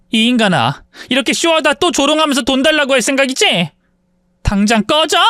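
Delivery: angry yelling. Acted. Wife hits husband, tells him leave.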